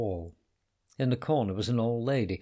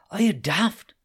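hissed rebuke; 12 semitones higher, 8 dB louder than usual. A narrator's reading. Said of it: "she hissed".

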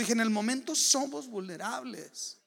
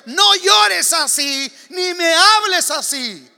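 slow, louder, higher stern command; God's stern rebuke; 10 semitones higher, 16 dB louder, slower than usual. Retold or imitated. The Lord rebuking the weeping preacher, slow and stern. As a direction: let God's rebuke land slowly. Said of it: "Me dijo el Señor".

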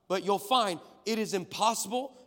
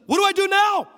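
much higher, louder astonished woman's voice; amazed woman; 13 semitones higher, 14 dB louder than usual. Retold or imitated. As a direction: play the stunned healed woman. Woman voiced in healing story.

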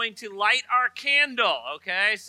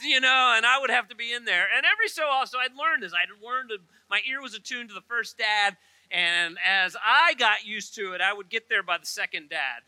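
normal talking voice

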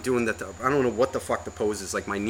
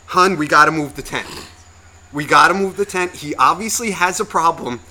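louder, higher mock plea to a partner; mock-urgent retold appeal; imitated urgent wife; 5 semitones higher, 6 dB louder than usual. Neighbor's wife urging her husband to move the tent, mock urgent.

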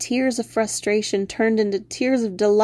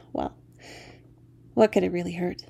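usual speaking voice

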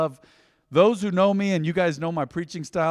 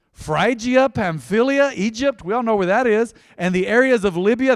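raised, emphatic scripture reading; 4 semitones higher, 4 dB louder than usual. Acted. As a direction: proclaim the list of nations to the church. Reads the Acts passage.

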